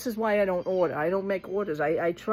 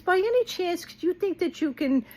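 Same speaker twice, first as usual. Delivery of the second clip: mock polite agent; 11 semitones higher than usual. Retelling the phone agent's request.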